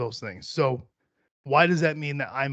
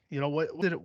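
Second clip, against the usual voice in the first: ordinary talk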